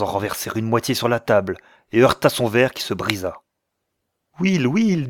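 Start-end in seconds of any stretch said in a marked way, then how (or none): 4.4-5.1 higher-pitched, pleading appeal. A narrator's reading. Clara begging her cousin to stop quarrelling, pleading. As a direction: call his name imploringly. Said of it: "avec des yeux suppliants".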